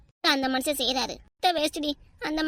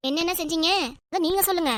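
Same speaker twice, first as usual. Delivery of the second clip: ordinary talk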